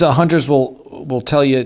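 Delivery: usual speaking voice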